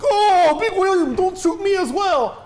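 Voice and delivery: put-on high, loud, pleading Russian accent; a scared gangster begging in a Russian accent. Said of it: "I can't do a Russian accent".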